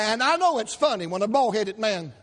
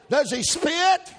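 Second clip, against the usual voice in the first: hammed-up nosy hairdresser; 5 semitones higher, slower than usual. Hairdresser grilling the customer about his preacher, high and prying. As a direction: needle him like a shocked gossip.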